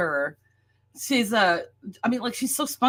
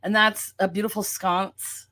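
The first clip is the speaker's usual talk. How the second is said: ordinary talk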